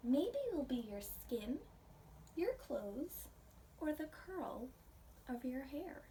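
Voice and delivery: gentle storybook read-aloud for a class; reading to children. Acted. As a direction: read it like a picture book to little ones. Reading picture book to class.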